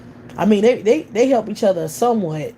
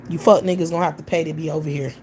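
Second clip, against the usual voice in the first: ordinary talk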